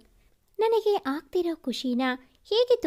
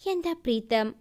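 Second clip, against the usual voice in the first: ordinary delivery, nothing marked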